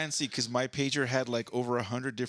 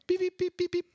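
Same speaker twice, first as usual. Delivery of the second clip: sehr hohes, lautes Piepen; Nextel-Piepton; 4 dB louder than usual. Retold or imitated. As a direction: imitiere den Funkpiepser des Handys. Imitates Nextel walkie-talkie chirp.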